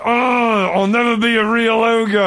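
put-on high, loud whiny teen ogre. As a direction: wail it like a sulky teenage ogre. Shrek voiced crying in room.

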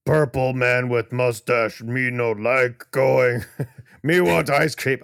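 slow, loud, dim-witted brute voice; dopey cartoon bellow. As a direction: boom it out like a simple giant. Voicing Bizarro, dumb speech.

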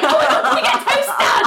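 loud, high squeal of joy; hammed-up childish excitement; overexcited kid. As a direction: imitate a thrilled child, half laughing. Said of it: "the most exciting thing in the entire world".

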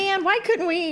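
high laughing exclamation. Real, not acted. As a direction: laugh it out in disbelief. Spotting the guys again, laughing.

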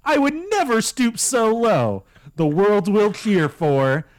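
high, dramatic refusal slowing to a grand finish; loyal boy band member. Acted.

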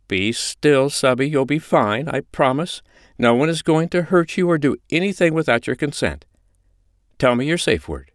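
high-pitched, firm comforting voice; steady them with firm reassurance; reassuring mistress. Acted. China calms a panicking Holly, firm and reassuring.

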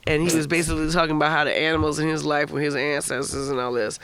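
ordinary delivery, nothing marked

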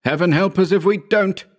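high, wavering moan of dread. A narrator's reading. Howard despairing of reaching the farm, high moaning. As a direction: moan it, pitched far up. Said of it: "moaned Howard".

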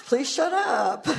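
mock-exasperated husband, laughing through it; husband's exasperation. Husband telling her to stop overthinking, laughing mock plea. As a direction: playfully groan at her. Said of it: "my husband's like".

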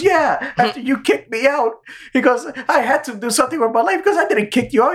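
high, fast cartoon duck rant; Daffy voice. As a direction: pitch it up and rush as Daffy.

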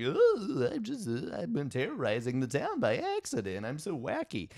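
high-pitched, goofy cartoon dragon; wacky dragon voice. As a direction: do a silly dragon in a high, swooping voice. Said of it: "he's got a wacky voice".

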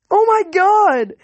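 high, slow, shrieking squeal; screaming girls. A narrator's reading. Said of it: "half the girls screamed at once".

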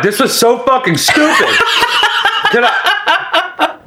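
high laughing words; pained laughter. Real, not acted. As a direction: laugh helplessly through it. Laughing in pain after chip.

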